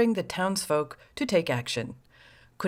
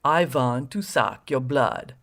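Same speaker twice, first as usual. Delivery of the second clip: slow, lower, hammed-up Transylvanian vampire accent; mock Transylvanian accent; 4 semitones lower, slower than usual. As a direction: drag out a cheesy Transylvanian Dracula accent. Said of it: "in her very best Transylvanian accent".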